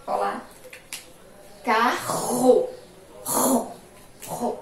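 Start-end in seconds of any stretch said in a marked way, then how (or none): 1.6-4.6 Carro with a strong throaty RR; strong double R demo. Shown as a demonstration. Teacher showing the double R in Carro, strong. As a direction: say Carro, then ro, ro, with a hard throaty R. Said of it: "O som aqui foi forte".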